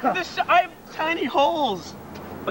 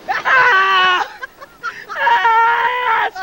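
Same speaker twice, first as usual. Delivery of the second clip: screaming; 5 semitones higher than usual. Real, not acted. Blasted by car wash water.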